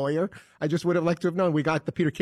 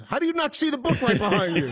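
higher-pitched sarcastic reprimand; mock scolding; 6 semitones higher than usual. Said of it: "How should I say it?".

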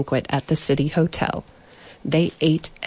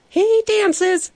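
much higher, louder delighted exclaim; the coffee woman bursting out in delight; excited exclamation; 4 dB louder than usual. A narrator's reading. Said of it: "exclaimed the woman who brewed".

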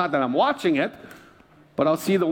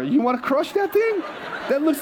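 laughing; 7 semitones higher than usual. Retold or imitated. Laughs while quoting himself.

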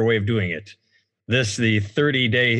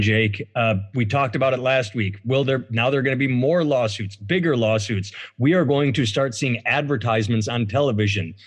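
ordinary delivery

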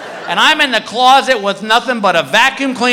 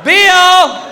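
high, loud searching call; 11 semitones higher, 5 dB louder than usual. Acted. Wife calling to husband hidden in closet, high holler. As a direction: holler high for someone out of sight. Wife calls again, no answer.

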